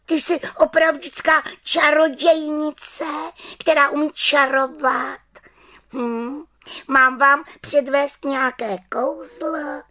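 high, loud little witch voice; little witch offering. A narrator's reading. Little witch eagerly offers the children a magic trick. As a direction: eagerly offer to show a spell. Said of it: "zeptala se malá čarodějnice".

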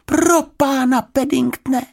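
high, loud cry of dismay; girl horrified at the soaked bear; alarmed exclamation. Acted. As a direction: gasp his name in alarm. Girl finds bear nearly drowning.

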